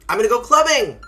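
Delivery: loud, high burst of party excitement; pumped-up shout about going clubbing; excited clubbing boast. Pretend. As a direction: announce it like a big night out. Dad boasting about nightclub plans.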